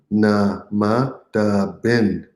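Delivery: stretched out very slowly; very slow model phrase. Shown as a demonstration. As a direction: spell it out with your voice, slowly. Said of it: "You say it".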